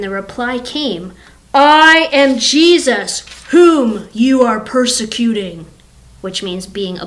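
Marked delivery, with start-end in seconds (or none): 1.5-5.7 slow, loud divine declaration; best God voice. Acted. The Lord answers Saul on the road, big God voice. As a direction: proclaim it slowly as the Lord. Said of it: "this is my best God voice".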